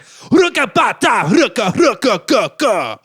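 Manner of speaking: loud, high, slow DJ chant; Jamaican radio DJ. Retold or imitated. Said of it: "And he's like".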